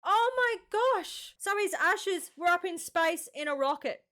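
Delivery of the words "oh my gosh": high, slow, astonished exclamation; awestruck, pitched up; amazed. Real, not acted. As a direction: draw it out in real wonder. Reacting to ashes sent into space.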